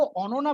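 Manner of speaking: "ओनोना" with rounded o vowels; Bengali pronunciation. Shown as a demonstration. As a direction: Bengali way of saying Ananya as "ओनोना". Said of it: "मान लो ओनोना बोलती".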